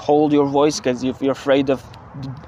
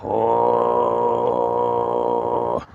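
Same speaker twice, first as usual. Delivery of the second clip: one long, very low guttural note; deep subharmonic drone; 14 semitones lower than usual. Shown as a demonstration. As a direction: hold a single rumbling kargyraa note. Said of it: "Just sing one note".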